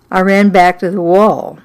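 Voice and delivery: plain reading voice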